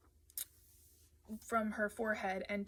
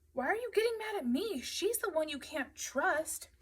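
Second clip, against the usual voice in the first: high, loud, whiny indignation; indignant protest; 9 semitones higher, 5 dB louder than usual. A narrator's reading. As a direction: protest high, then stretch the accusation. Matthew protests in acted quarrel.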